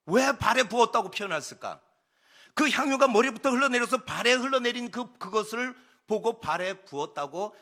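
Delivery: high, loud, insistent sermon voice; full-force preaching. Acted. Preacher explaining why John wrote of the feet, impassioned. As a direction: throw it out to the congregation forcefully.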